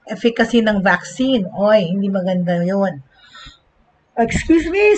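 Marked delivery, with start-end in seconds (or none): 4.2-5.0 through a yawn. Real, not acted. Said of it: "sorry, I yawned".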